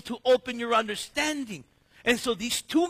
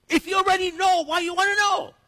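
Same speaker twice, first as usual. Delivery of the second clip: incredulous; 9 semitones higher, 7 dB louder than usual. Retold or imitated. Mock puzzled question about Jesus.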